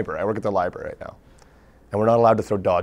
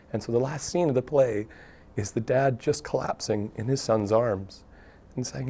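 ordinary talk

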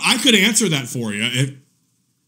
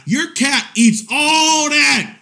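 loud, high, slow emphatic yell; shouting; 8 semitones higher, 4 dB louder, slower than usual. Real, not acted. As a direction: hammer it out loud. Emphatic rant punchline.

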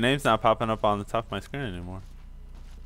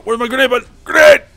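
shouting; 11 semitones higher, 14 dB louder, faster than usual. Real, not acted. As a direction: yell out the grenade warning, high. Throwing grenade in game.

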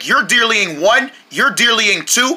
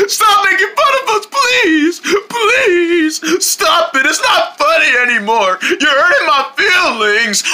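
high, tearful pleading, much higher; pretend crying; 9 semitones higher, slower than usual. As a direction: whine it out like a hurt kid begging them to stop. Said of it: "Yes, I am gonna cry".

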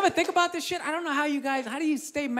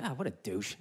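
much lower, much softer scoffing aside; inner voice; 12 dB quieter than usual. Retold or imitated. His own mind sneering at the therapist, low and soft. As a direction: scoff it under your breath, low. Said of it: "my brain was like".

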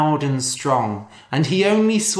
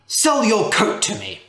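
a raised demand, higher and louder; said loudly; 6 semitones higher than usual. Acted. Rich man loudly demanding the shabby coat, a raised call. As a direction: call out, insisting on the deal. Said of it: "The rich man said loudly".

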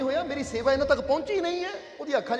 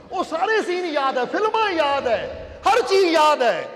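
building sermon; 3 semitones higher, 4 dB louder than usual. Acted. Preacher builds a list.

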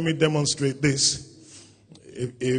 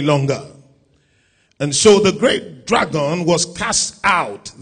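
loud, high, declaiming Revelation; preaching scripture reading; 6 semitones higher, 11 dB louder than usual. Acted. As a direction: thunder the verses at the church. Reads Revelation at full force.

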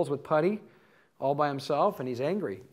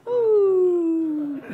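mock swooning ooh; 6 dB louder than usual. Mocking the sappy video music.

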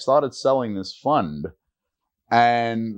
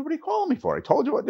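high, irritated brush-off; irritated doctor; much faster than usual. Retold or imitated. Doctor annoyed the salesman phoned him, irritated. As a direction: sound put out at being bothered.

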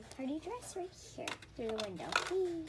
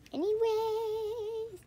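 high, slow stretched anyways; sing-song anyways; 11 semitones higher, 5 dB louder, much slower than usual. Acted. Vlogger moving on from a laugh, high stretched anyways. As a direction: draw out the word anyways, much higher.

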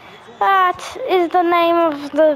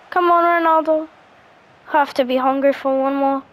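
ordinary talk, nothing marked

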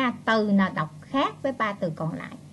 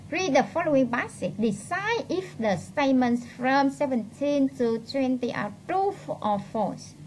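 reading instructions aloud; 3 semitones higher, slower than usual. Real, not acted. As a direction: read the exam rubric out carefully, raised pitch. Reads exam instruction aloud.

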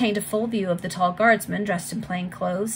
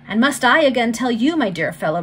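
loud, falsely friendly retort; bitter affected familiarity; 5 semitones higher, 7 dB louder than usual. A narrator's reading. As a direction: feign friendliness with a bitter edge. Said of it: "affectation of familiarity which only made his words the more bitter".

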